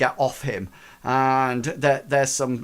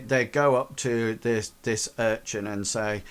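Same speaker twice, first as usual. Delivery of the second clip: usual speaking voice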